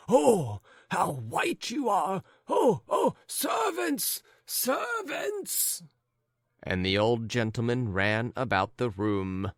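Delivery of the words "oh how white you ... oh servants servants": very high, rising panic; panicked calling. Acted. Old husband calling the servants in panic, very high. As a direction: call for help in a fluster.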